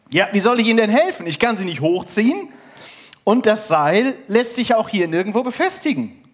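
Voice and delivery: hoch, laut und hastig; verzweifelt. Acted. Wandersmann verzweifelt am Seil. (verzweifelt, hoch und schnell)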